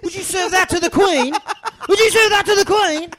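high, loud British chef yelling; furious chef. Retold or imitated. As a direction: scream it at the kitchen. Gordon Ramsay voice continued.

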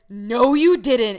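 loud, slow, stretched disbelief; all caps reply. Retold or imitated. Daughter reading her all-caps text: loud and slow. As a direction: read it out like it's typed in caps. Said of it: "in all caps".

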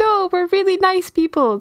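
high, sugary protest of innocence; innocent cultist. Pretend. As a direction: insist you're harmless, sweetly. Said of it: "they're like".

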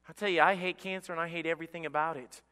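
ordinary talk

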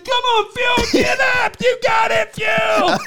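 very high, drawn-out fan yell; will the fallen wrestler up, screaming; desperate mock cheering. Superfan heartbroken as wrestler is down, desperate yelling. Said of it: "you just saw his heart break".

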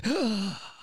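high shocked gasp of a prim church lady. Retold or imitated. Church lady gasping at the boy's voice. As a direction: gasp like a startled old lady. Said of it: "and they'd say".

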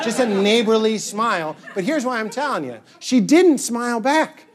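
ordinary talk, nothing marked

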